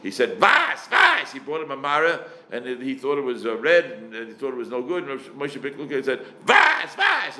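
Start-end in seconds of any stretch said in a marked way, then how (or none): 0.4-1.3 high-pitched mock yell; imitated screaming. Retelling a rabbi ruling on a question, imitated screaming. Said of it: "Moshe Big screamed all the time". 6.4-7.4 high-pitched mock yell; imitated screaming. Retelling a rabbi ruling on a question, imitated screaming. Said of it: "Moshe Big screamed all the time".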